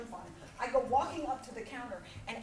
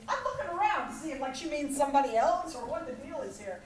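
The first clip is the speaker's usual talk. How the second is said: ordinary talk, nothing marked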